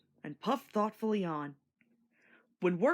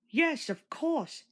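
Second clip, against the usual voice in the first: slow, higher gentle cut-in; patient interruption; 8 semitones higher, slower than usual. A narrator's reading. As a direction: break in kindly and slowly. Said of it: "interrupted Edward".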